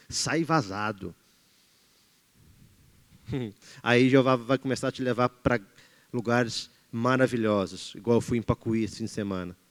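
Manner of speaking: relaxed and soft. Real, not acted. Relaxed reminiscing after laugh.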